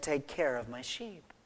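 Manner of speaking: low, soft instruction; calm quiet charge. Acted. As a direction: answer the outburst softly and steadily. Acted Jesus's calm reply to Peter.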